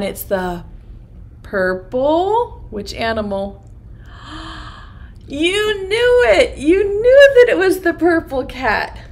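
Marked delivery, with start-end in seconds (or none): 4.1-9.0 mock gasp of surprise. Teacher faking amazement at the children's guess. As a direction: act amazed at the answer.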